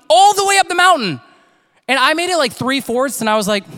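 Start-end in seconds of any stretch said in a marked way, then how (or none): 0.1-1.2 loud, high storytelling climax; amazed emphasis. Real, not acted. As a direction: belt it out to hammer home the feat.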